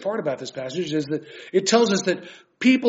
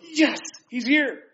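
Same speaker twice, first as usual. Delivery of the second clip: joyful greeting; 8 semitones higher, slower than usual. Pretend. Imagined joy at Jesus' coming.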